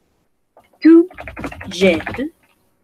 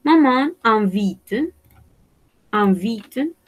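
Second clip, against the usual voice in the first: slow model answer; much slower than usual. Shown as a demonstration. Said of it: "Qué sería la conjugación".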